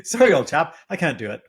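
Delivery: hammed-up upper-class British; British accent, retried. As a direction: lay on the posh British harder. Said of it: "That's a terrible British accent".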